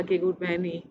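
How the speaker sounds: plain reading voice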